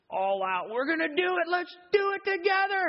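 high, loud rallying yell; all-out hype. Acted. Preacher mimicking men going all out, excited shout. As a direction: shout it like charging into action.

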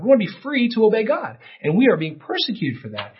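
loud, high impassioned preaching; pulpit-pounding sermon climax; fiery preaching. Acted. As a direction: preach it with full force, rising fervor. Preaching at full force.

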